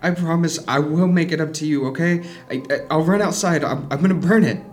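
agitated, insistent reassurance; urgent promising. Acted. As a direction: promise frantically to make amends. Kai promises urgently to fix it.

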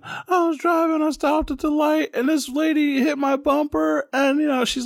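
high, upset voice; high, upset imitation of a crying girlfriend; panicked girlfriend. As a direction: voice a hysterical pregnant partner. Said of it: "What happened?".

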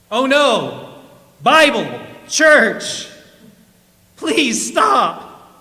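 high, loud desperate cries, word by word; hammed-up panic on a runaway donkey; panicked shouting. A narrator's reading. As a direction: shout out any word that might stop it. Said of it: "shouted the man".

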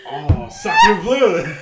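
loud, slow, laughing French exclamation; french chef. Retold or imitated. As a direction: belt it like an outraged French chef. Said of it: "And then you're like".

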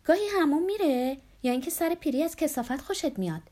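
high, heated taunt; losing her temper. A narrator's reading. (از کوره در رفته، با صدای زیر)

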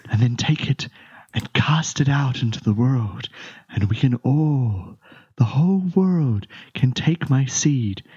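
breathy spirit whisper swelling to slow proclamation; whispering tree voice. Acted. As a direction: start hushed and approving, build to a grand slow prophecy. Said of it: "you hear the, the voice".

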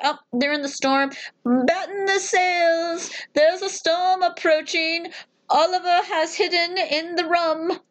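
slow, high dramatic sailor cry. Pretend. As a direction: call the storm warning slowly. Acted sailor warning.

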